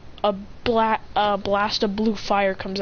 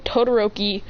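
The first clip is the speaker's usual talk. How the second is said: ordinary delivery, nothing marked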